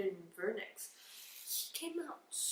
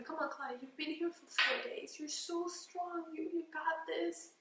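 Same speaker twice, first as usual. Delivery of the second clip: higher, upbeat cheering on; encouraging team; 6 semitones higher than usual. Retold or imitated. Nurses urging Claudia to push, encouraging. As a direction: cheer her on gently. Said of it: "they kept telling me".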